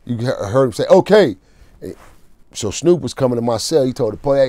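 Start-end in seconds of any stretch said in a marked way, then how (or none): 0.9-1.4 louder, higher mock officer shout; officer's call. Repeating what the officer said, raised imitated call.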